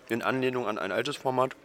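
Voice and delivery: normal talking voice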